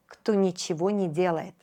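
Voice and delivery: usual speaking voice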